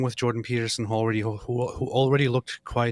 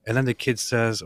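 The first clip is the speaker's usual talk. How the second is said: ordinary delivery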